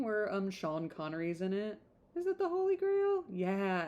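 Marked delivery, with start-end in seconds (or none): 2.1-3.2 much higher, checking herself; unsure question. Real, not acted. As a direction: pitch Grail up in doubt. Unsure of film title.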